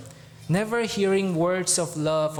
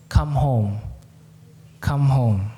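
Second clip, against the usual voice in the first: low, loud and pleading; tender invitation; 5 semitones lower, 4 dB louder than usual. Acted. Preacher voicing Jesus inviting sinners home, earnest. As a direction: call them home gently but firmly.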